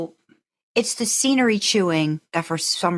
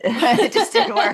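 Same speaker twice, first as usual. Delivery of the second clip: laughing; 13 semitones higher, 4 dB louder than usual. Real, not acted. Laughing while judging the character.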